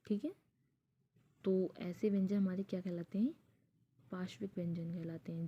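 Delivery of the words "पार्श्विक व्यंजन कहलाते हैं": usual speaking voice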